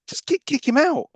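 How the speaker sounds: exasperated outburst. Real, not acted. Exasperated at the film plot.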